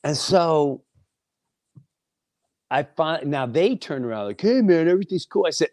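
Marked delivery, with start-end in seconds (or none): 4.4-5.4 breezy high dude voice, quick; mock dude voice. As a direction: toss it off like a careless guy. Mocking the young man.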